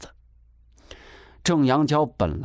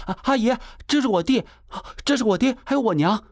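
put-on high-pitched, flustered young woman; hurried explaining; 12 semitones higher, faster than usual. A narrator's reading. Sister introduces family to angry landlady, hurried explaining. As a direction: explain anxiously in short high bursts. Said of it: "贾秀急忙解释道".

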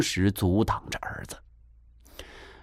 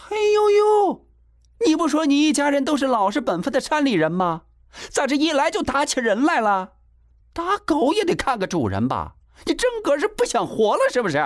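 very high, fast, scornful tirade; furious berating. A narrator's reading. Landlady berates mountain family for hitting people, furious berating. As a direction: tear into them at top pitch.

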